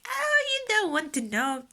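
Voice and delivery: high-pitched mock dismay; imitated husband. Husband answering about the stitches, high mock dismay. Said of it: "My husband was like".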